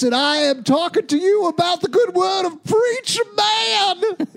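booming hyped preacher voice, high and loud; monster truck ad. Acted.

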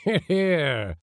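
high, loud cheer of approval; toast-table cheer; hearty approval. Acted. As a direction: cheer on the speechmaker. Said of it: "Villona saying".

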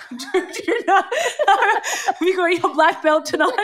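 high-pitched through giggles; giggly, squealed ribbing; laughing tease. Real, not acted. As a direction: tease a friend while cracking up. Teasing friend while laughing.

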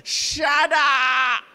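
crying toyol shout; tearful spirit yell; ghost wail. Acted. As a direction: howl it like a wounded spirit. Ghost wails.

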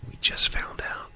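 hushed secret whisper; whispering on the phone. Real, not acted. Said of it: "Why are you whispering?".

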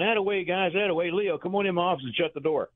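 high-pitched retold congratulations and summons; imitated calling out. Manager comes in after the win, imitated high-pitched calling.

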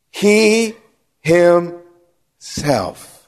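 "He himself" in weighty, separated beats; drawn-out emphasis. Real, not acted. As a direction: stretch out "He himself" with pauses. Repeats the emphasized key words.

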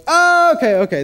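wife suddenly understanding the strangers, high startled oh; dawning realization. Retold or imitated. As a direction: let it click, high then drop. Realization it all clicked.

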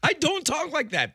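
high-pitched protest; indignant protest. Real, not acted. Protests the mocking impression.

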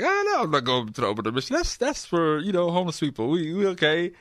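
high-pitched, scoffing brush-off; laughing dismissal. Retold or imitated. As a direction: wave it off as not for you. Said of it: "I laughed when they suggested it".

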